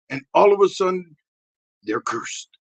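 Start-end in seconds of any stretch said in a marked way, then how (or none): none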